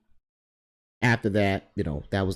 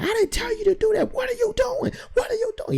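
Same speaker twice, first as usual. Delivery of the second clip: very high-pitched, frantic yelling; imitating yelling boss. Boss scolding worker on the stuck tractor, imitated high-pitched yell. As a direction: holler it as the exasperated boss. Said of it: "he's yelling at me".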